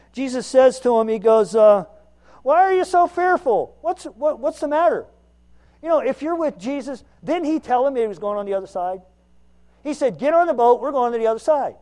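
loud, driving sermon build; fiery preaching. Acted. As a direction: preach it out, full voice. Preaching at full force.

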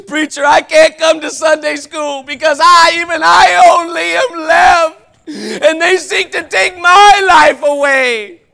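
loud, high, hammed-up wail; mock whining excuse. Churchgoer wailing to the preacher why he skips Sunday school, mock whining. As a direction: ham up a melodramatic excuse.

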